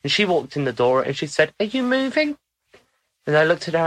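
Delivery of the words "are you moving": much higher, rising mock-surprised question. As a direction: imitate a visitor's high-pitched query. Retold question from a surprised visitor.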